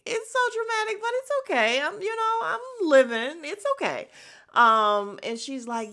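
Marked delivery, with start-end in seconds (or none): 0.1-4.1 high, theatrical "I'm fine" sing-song; campy high-pitched sarcasm; mock dramatic. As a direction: act overly brave about the drama. Mocking the drama in her head.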